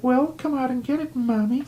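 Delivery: very high, taunting sing-song; creepy monster voice. Retold or imitated. Dream monsters taunting the girl, very high creepy voice. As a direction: tease her like a cartoon monster. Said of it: "It's like".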